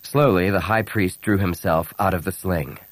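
usual speaking voice

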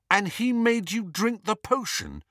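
high-pitched disgust. A narrator's reading. Harry questioning the elf about the potion, disgusted. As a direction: say it appalled and disgusted.

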